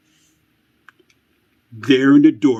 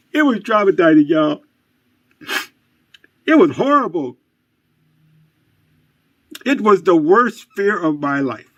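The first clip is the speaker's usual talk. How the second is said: hammed-up trauma; 6 semitones higher than usual. Host tells viewers his childhood scare, hammed-up distress. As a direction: overplay the childhood horror.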